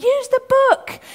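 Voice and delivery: high, loud urgent cry; yelling at the screen. Retold or imitated. Family urging on a film hero, yelling. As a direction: shout it like an exasperated film viewer.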